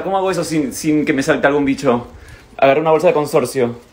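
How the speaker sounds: ordinary talk, nothing marked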